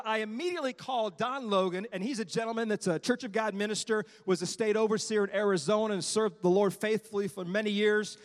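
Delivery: normal talking voice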